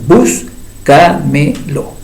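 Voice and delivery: "búscamelo" very slow, split bús-ca-me-lo; syllable by syllable. Shown as a demonstration. Teacher showing four syllables, "búscamelo" slowly split. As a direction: count out each syllable of "búscamelo". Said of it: "cuatro sílabas".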